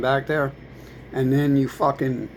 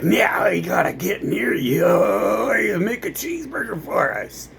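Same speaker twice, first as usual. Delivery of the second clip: higher mock shout of demands; mock demanding voice; 6 semitones higher than usual. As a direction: bark orders as a caricature. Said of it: "And they're like".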